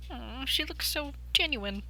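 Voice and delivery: soft slow fond coo; tender aside. Real, not acted. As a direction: coo it softly.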